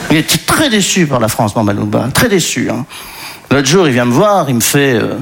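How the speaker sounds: normal talking voice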